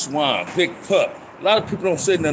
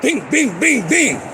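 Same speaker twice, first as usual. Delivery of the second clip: punch sounds; 11 semitones higher, 4 dB louder, slower than usual. Retold or imitated. Imitating punches in a fight.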